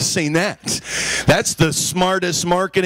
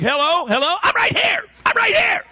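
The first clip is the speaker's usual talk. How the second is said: screaming into the phone; 11 semitones higher than usual. Retold or imitated. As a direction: shout to be heard on a dropped call. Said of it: "You're just screaming at the top of your lungs".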